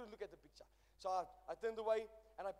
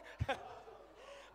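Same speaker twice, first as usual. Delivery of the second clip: breathy laugh. Real, not acted. Laugh between shouts.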